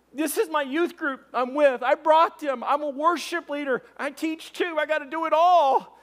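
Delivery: high-pitched, eager rush of news; excited catching up. Retold or imitated. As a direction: tumble out your news, voice way up. Friend excitedly telling his news.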